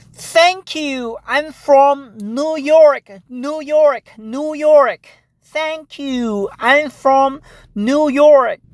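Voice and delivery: exaggeratedly slow, high, careful answer; slow, careful enunciation. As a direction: raise the pitch and stretch each word. Answering in the same slow foreigner-talk.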